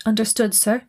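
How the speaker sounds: lightly voiced Austrian accent. A narrator's reading. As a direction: answer with a touch of an Austrian accent. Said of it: "A fuzzy voice with a well-known Austrian accent".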